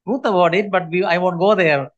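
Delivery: ordinary talk, nothing marked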